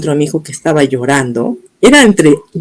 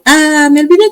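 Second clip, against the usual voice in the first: imitated psychiatrist's excuse; 10 semitones higher than usual. Psychiatrist telling patient she forgot, mimicked casually. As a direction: mimic a casual oops from a doctor. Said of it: "la psiquiatra agarra y me dice".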